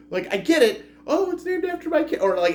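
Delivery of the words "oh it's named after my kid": very high-pitched mock-cutesy dad. Reviewer mimicking the dad who named the project, cutesy. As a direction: put on a high, cutesy sweetness. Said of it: "it's a cutesy, like".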